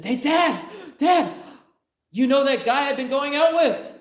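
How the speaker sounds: high, loud, excited daughter's voice; giddy daughter. Acted. Daughter running in to tell her dad, high and giddy. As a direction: burst in as a thrilled teenage girl. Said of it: "and she says".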